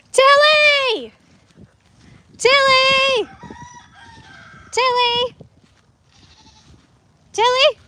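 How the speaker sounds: high, loud repeated calls; calling out. Real, not acted. Owner calling her goat Tilly across the pasture, loud high calls.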